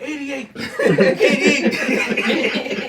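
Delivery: high, loud laughing shout; mock shout. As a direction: yell it playfully. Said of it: "I just be like".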